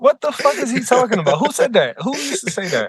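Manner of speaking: high-pitched disbelief; incredulous outburst. Real, not acted. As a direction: react in real disbelief, pitch jumping up. Incredulous reaction to the reported saying.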